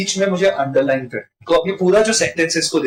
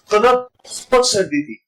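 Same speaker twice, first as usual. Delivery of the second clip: slight break, then a weighted बहुत; stress on बहुत; 5 semitones higher than usual. Shown as a demonstration. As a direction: underline बहुत as more than usual. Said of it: "आमतौर पे इतनी नहीं होती". (बहुत पर ज़ोर देकर)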